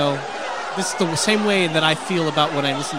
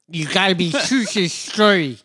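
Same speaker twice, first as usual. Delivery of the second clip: put-on nerdy kid with a retainer; nerd voice; 3 semitones higher, 4 dB louder than usual. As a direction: talk around a retainer. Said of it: "like he's choking on a retainer".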